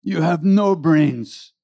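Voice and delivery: high, slow teasing voice; playful guru ribbing his devotee. Retold or imitated. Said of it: "looks at Dada and says".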